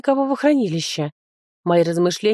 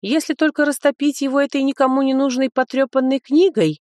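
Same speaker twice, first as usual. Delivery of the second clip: deliberately loud; 7 semitones higher than usual. Acted. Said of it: "нарочито громко высказалась я".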